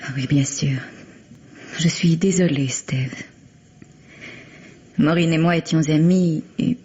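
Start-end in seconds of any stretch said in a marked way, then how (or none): 0.0-0.8 hushed, almost no voice, sympathetic. Acted. To the grieving husband, whispered condolence. 2.7-3.1 softer, a breathed name; soft whisper. Acted. To grieving Steve, whispered name. Consoling the widowed husband.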